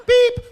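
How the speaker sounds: shrill high loud beep; loud alarm beep. Retold or imitated. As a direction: blast it like an alarm. Alarm goes off.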